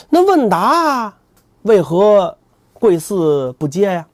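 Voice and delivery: slow, stretched pious question; drawn-out plea. Acted. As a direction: stretch each word like a chant. 唐僧拖长质问. (缓慢拖长的僧人腔)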